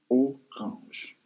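"Orange" split into oh, rahnzh; syllable-by-syllable French. Shown as a demonstration. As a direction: teach French "Orange" in two separate syllables. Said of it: "And in French, it is".

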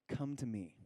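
soft, tender call; gentle invitation. Acted. Pastor voicing Jesus to the weary, softly tender. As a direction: invite them in quietly.

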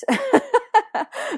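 a high, loud laugh at herself; bright burst of laughter. Real, not acted. Guest laughing at her own bubbly nature, loud laugh. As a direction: laugh out at being the opposite of shy. Laughing about not being shy.

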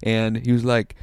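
ordinary talk

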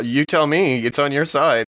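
ordinary delivery

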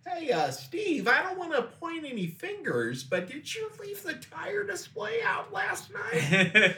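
squeaky accusing manager; high-pitched questioning boss. Acted. Dealership boss questioning employee.

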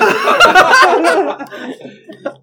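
laughing loudly while teasing. Real, not acted. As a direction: cackle through the taunt.

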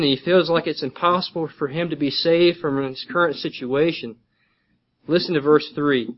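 ordinary talk, nothing marked

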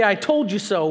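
sing-song gloating; mock gloating; told-you-so. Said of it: "don't necessarily be".

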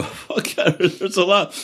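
high, laughing answer to the doctor; high giggling reply, unvoiced start. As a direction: laugh through it, half shouted.

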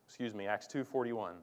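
ordinary talk

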